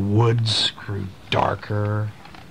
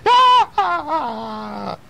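high, loud startled shout; a scared yelp as the horse bolts; frightened cry; 14 semitones higher, 4 dB louder than usual. Acted.

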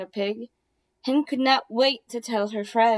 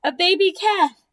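high, loud joyful yippee; excited cheer; 6 semitones higher, 6 dB louder than usual. Acted. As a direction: cheer like happy barnyard friends. Animals cheer the newborn.

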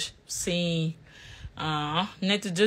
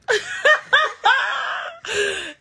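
high loud laugh bursting out; burst of laughter; 5 dB louder than usual. Real, not acted. Mother laughing out loud at her son's French.

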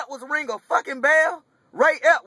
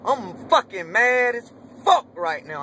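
ordinary talk, nothing marked

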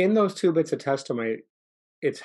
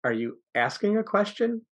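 "are you asking a question?" sliding much higher at the end; up talk; 9 semitones higher than usual. Shown as a demonstration. Coach showing the class what up talk sounds like, rising sharply. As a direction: swing the pitch up high on "question". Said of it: "up talk is where when you finish the sentence and go up".

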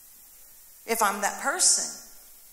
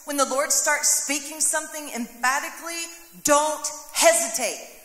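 ordinary talk, nothing marked

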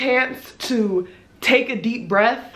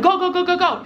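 higher, urgent repeated command; imitated hurrying worker; 7 semitones higher than usual. Worker hurrying riders out for the next group, imitated urgency. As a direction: mimic a worker rushing people out. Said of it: "So she's like".

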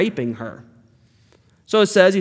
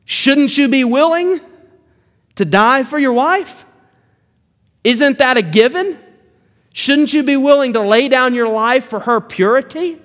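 much higher and louder, demanding; indignant challenge; 7 semitones higher, 3 dB louder than usual. Acted. Preacher challenging Isaac on his duty, indignant raised voice. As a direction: press the question hard, incredulous.

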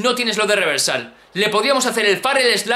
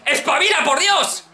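high-pitched sarcastic thanks turning to urging; exasperated yelling; 3 semitones higher than usual. Real, not acted. Gamer telling Samoa Joe to get up, exasperated. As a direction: scold your wrestler in a raised, frustrated voice. Exasperated at his game character.

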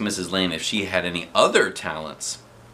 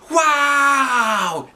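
high, loud amazed shout; kids' wow; 5 dB louder than usual. A narrator's reading. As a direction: shout it out in wonder. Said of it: "everybody said".

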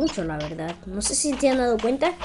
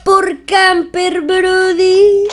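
drawn-out shout; 7 semitones higher, 8 dB louder, much slower than usual. Real, not acted.

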